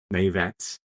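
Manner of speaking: normal talking voice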